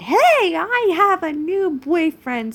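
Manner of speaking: loud, high singsong boast; mocking flaunting. Reader mimics character flaunting new boyfriend, mocking boast. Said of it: "you kinda walked in and just flaunted".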